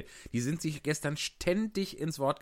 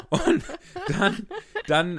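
laughing; 8 dB louder, much slower than usual. Real, not acted. Laughing while going on.

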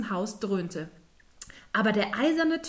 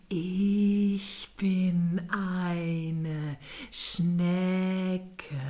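very slow, unruffled snail drawl; calm, slow snail; 3 semitones lower, much slower than usual. Acted. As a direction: say it with total calm. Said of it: "in aller Ruhe und Gleichmut".

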